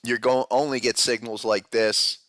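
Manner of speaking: ordinary talk, nothing marked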